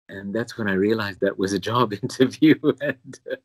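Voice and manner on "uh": ordinary talk, nothing marked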